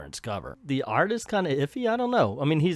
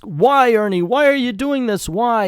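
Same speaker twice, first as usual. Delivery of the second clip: high, loud acted plea; hammed-up victim's wail; desperate pleading; 9 semitones higher, 9 dB louder than usual. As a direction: cry out the questions in mock anguish. Voicing victims pleading with Ernie.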